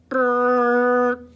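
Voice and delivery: loud buzzing horn blast; horn honk. A narrator's reading. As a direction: blare it like a truck horn.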